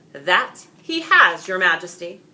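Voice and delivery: a knight confirming victory to the king; knightly reply. Acted. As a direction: answer the king proudly.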